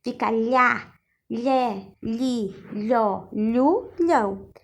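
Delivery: slow clear syllables, lha lhe lhi lho lhu lhão; syllable drill. Shown as a demonstration. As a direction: sound out each LH syllable crisply. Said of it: "Quando o H vem junto". (sílabas lha, lhe, lhi, lho, lhu, lhão bem articuladas)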